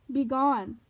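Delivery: booming loud command; bellowing. Acted. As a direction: blast it like a god. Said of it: "Ares bellowed".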